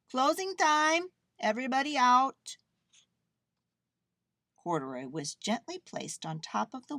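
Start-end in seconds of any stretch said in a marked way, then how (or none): 0.1-2.6 calling out. A narrator's reading. Manager to everyone in the laundromat, calling out. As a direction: call out to the whole room. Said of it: "the manager of the laundromat called".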